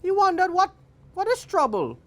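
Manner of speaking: high-pitched puzzled wondering; high, curious monkey musing. A narrator's reading. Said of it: "Monkey was curious".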